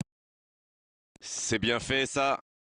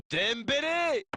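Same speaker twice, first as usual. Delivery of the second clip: excited commentary call; 12 semitones higher, slower than usual. Acted. Calling the shot.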